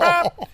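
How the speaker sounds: high-pitched vocal honk; short imitated horn toot; car horn honk. Niece honks at the car ahead, imitated honk. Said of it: "She hits the horn".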